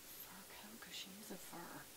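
soft unvoiced whisper to viewers; hushed aside. Real, not acted. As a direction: keep it hushed so she stays calm. Whispering beside calm dog.

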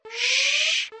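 a high squirrel's sleepy shush. A narrator's reading. Merle shushes the alarm, high sleepy shush. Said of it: "Merle repeated".

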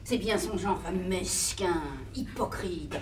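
soft, slow and venomous; spiteful low mutter. Acted. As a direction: hiss the insult quietly.

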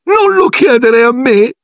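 shouting ghost. Acted. Ghost girl yelling at Harry from the flooded stall, high slow shout. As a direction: hurl it back at him, offended. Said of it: "gridò Mirtilla".

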